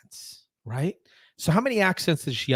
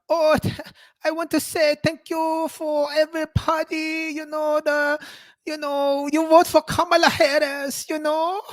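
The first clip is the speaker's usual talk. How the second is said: high mocking put-on Pacific Islander accent; 9 semitones higher than usual. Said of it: "is she gonna bust out".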